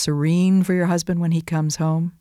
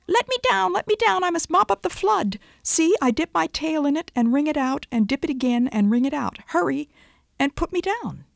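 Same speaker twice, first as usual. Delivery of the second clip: much higher, pleading little critter voice; squeaky chipmunk cry; 12 semitones higher than usual. Acted. Preacher voicing a chipmunk begging the Great Spirit, high squeaky plea. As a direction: squeal it like a tiny frantic chipmunk.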